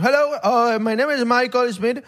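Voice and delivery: high, slow put-on American English accent, like a scam caller; imitating a gringo phone scammer in an American accent; fake American accent. Warning viewers about scam calls, mocking a caller's American accent. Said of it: "No caigan en ese acento".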